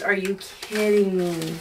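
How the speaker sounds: a lightly voiced little figure; toy's voice. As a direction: voice the crochet dude, unimpressed. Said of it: "he talks like this".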